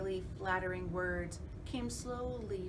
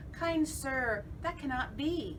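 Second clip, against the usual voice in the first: fly's voice; 7 semitones higher, 4 dB louder than usual. Acted. Fly refuses politely.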